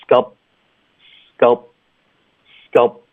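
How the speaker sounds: "sculpt" stopped on closed lips, p not released; unreleased p. Shown as a demonstration. Teacher showing students, "sculpt" with the p swallowed into a pause. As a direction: shut the lips to end "sculpt". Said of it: "我们得合上嘴唇".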